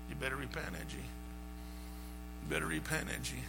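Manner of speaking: soft breathy inner voice, slowed; a quiet whispered warning to himself. Retold or imitated. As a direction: hush it like a conscience nagging. Inner voice urging him.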